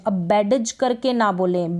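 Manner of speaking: ordinary delivery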